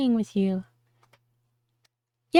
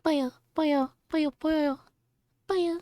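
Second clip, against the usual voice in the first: higher creature babble; Kirby noises; 7 semitones higher, slower than usual. Shown as a demonstration. Practising Kirby noises, higher babble. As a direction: babble like a little puffball. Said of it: "Just making Kirby noises".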